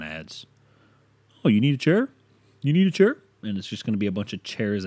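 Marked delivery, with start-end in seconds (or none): 1.4-3.2 higher, louder mock sales pitch; eager ad pitching over and over; pushy ad imitation. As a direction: pester with rising, needling questions. Imitating nagging Amazon ads.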